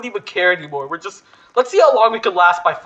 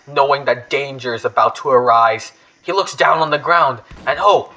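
dramatic story narration; 5 semitones lower than usual. Acted. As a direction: spin an ominous tale about the campsite. Dramatic narration of his character.

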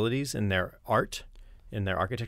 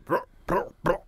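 higher barking brr sounds; playful dog-like bark noises; 8 semitones higher, 4 dB louder than usual. Shown as a demonstration. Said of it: "barking a little bit".

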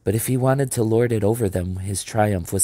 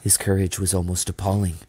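plain reading voice

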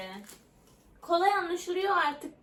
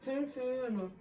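muffled through tape over lips; mouth taped shut. Real, not acted. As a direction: talk with lips taped closed. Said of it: "ağzın bantlı".

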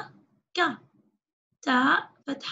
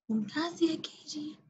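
whispered praise; 7 dB quieter than usual. Real, not acted. Teacher praising child softly.

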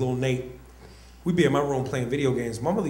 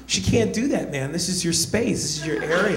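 lightly voiced outraged buddy, higher; 3 semitones higher than usual.

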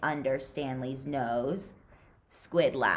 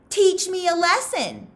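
much higher and loud, taunting; challenging; 8 dB louder than usual. Acted. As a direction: dare him to try. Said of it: "he challenged".

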